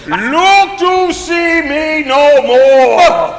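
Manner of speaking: slow, loud, drawn-out ghostly cry; booming ghost farewell. Acted. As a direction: boom it out as you vanish.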